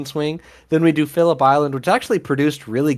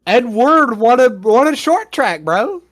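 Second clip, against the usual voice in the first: loud indignant protest; 11 semitones higher, 7 dB louder, slower than usual. Real, not acted. Heated defence of a driver.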